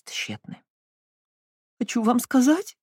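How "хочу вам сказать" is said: through tears. Acted. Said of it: "сквозь слёзы сказала она".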